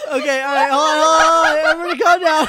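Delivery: loud high words through laughter; laughing shout. Real, not acted. Laughing, trying to regain control.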